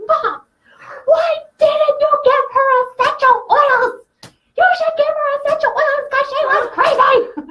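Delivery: put-on a squealing five-year-old outraged on mom's behalf; imitating her son. Mom retelling her son's outburst after the parking lot, high loud laughing kid voice. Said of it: "he slammed his hands on the table and he goes".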